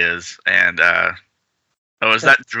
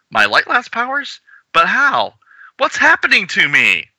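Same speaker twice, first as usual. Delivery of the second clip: alarmed panic; 13 semitones higher than usual. Acted. Character panics.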